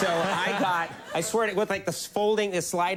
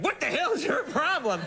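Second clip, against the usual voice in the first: exasperated dad; 12 semitones higher than usual. Retold or imitated. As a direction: throw up your hands at the wimpy student, half laughing. Dad voiced, exasperated at driving.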